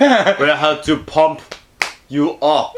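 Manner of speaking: put-on slow German bodybuilder accent; Arnold impression. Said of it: "Pumping Iron with Arnold".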